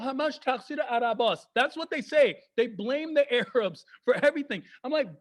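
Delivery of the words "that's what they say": normal talking voice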